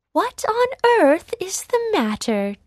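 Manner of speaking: high, lightly voiced kindly fairy; a gentle hint of a fairy godmother. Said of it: "the fairy godmother asked".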